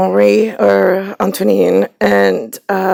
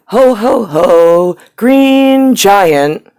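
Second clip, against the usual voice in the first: Green Giant mascot; 3 semitones higher, 5 dB louder, much slower than usual. Pretend. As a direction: belt it like the cheery giant mascot. Jokey Jolly Green Giant jingle.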